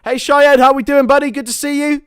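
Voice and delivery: high, loud, hearty hello; calling out a greeting. Real, not acted. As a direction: hail a buddy loudly. Calls out greeting to arriving viewer.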